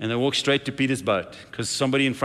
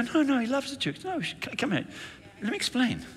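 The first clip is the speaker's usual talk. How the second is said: eager pleading; 11 semitones higher, 5 dB quieter than usual. Acted. Pastor acts out urgently correcting someone.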